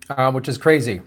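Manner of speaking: ordinary talk, nothing marked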